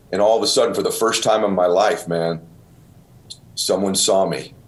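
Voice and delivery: normal talking voice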